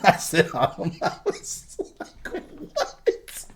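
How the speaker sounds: breathless, no voice, wheezing with laughter; silent laughter. Real, not acted. Streamer quoting his friend's line, wheezing with laughter. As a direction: laugh too hard to make a sound. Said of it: "I was crying so fucking hard".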